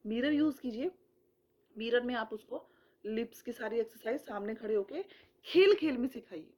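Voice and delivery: normal talking voice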